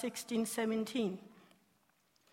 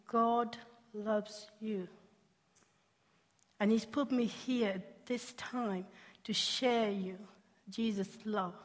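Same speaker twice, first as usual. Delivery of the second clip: tender reassurance; 2 semitones lower, 5 dB quieter, slower than usual. Retold or imitated. Comforting suicidal girl on bench.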